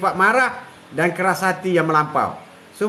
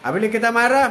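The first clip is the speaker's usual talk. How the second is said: ordinary delivery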